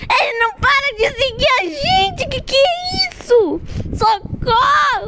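panicked crying shouts; being chased. Real, not acted. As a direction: plead for help in terror.

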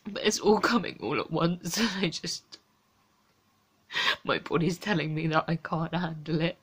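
quiet, tearful and worn out; exhausted, close to crying, barely above a murmur; near tears. Real, not acted.

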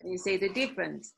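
ordinary talk, nothing marked

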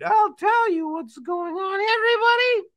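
very high-pitched puppet voice; high puppet confiding in everyone; Marmalade. Acted. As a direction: talk like a small squeaky cartoon friend.

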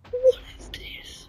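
slow scared whisper. Real, not acted. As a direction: whisper it in dread. Creepy mob appears.

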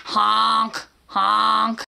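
honk. Shown as a demonstration. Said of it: "with a honk".

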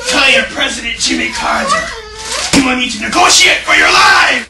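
loud, high threatening shouts; furious captor. Acted. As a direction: bark threats at the hostages. Hostage-takers yelling threats.

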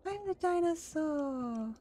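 long, high wail of playing dinosaur; playful dinosaur wail. Player role-playing the dinosaur, playful drawn-out wail. Streamer plays being a dinosaur.

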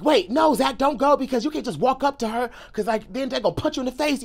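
imitating a worried friend's "don't go"; urgent warning. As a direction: hurriedly talk a friend out of it. Retold friend urgently warning him.

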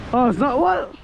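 ordinary talk, nothing marked

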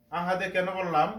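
ordinary delivery

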